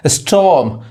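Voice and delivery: स्टॉर्म as "es-tawm", no r; silent r. Shown as a demonstration. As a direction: drop the r in स्टॉर्म. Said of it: "आर नहीं पढ़ाता है".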